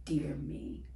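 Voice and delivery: Dear me whispered softly. Shown as a demonstration. Child starting a letter to herself, whispering. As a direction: breathe Dear me like a secret. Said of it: "All I have to do is start with".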